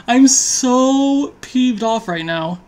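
very slow, high complaint; drawn-out complaint. Real, not acted. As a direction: stretch out the grumble. After disaster draw.

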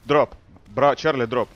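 sharp loud drop order; shouted command. Real, not acted. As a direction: bark the order over comms. Commanding squads to drop now.

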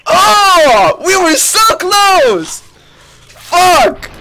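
high, loud, slow wail of near-miss; anguished cry. Real, not acted. Streamer to chat, just losing the round, crying out. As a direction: cry out the loss, dragging it.

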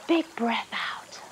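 slow whispered breathing cue; calm breath out. Shown as a demonstration. Leader guiding kids' breathing in a whisper.

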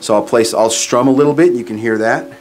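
ordinary talk, nothing marked

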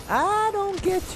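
high, slow and puzzled; a bewildered high whine at the enemy; mock puzzled squeak. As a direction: play dumb in a squeaky voice.

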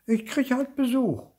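put-on high, insistent child's voice; defensive kid insisting; insistent repeat. A narrator's reading. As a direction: repeat it pointedly, pitched right up. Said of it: "wiederholt Nico".

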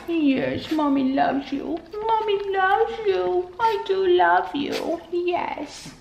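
soft high pet talk, close to the dog; cooing to the dog. Real, not acted. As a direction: murmur love to the puppy, high and hushed.

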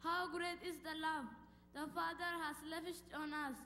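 ordinary talk, nothing marked

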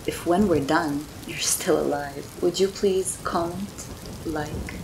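lightly spooky vampire host rhyming; a hint of a creepy crypt keeper; horror host. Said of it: "Do you mind if I break character?".

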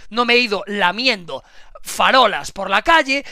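ordinary talk, nothing marked

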